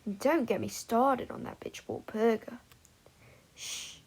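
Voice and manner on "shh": nervous hush. A narrator's reading.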